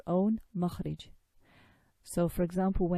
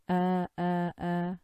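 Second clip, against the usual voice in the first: throat glottal demo; slower than usual. Shown as a demonstration. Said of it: "bottom of the throat and saying".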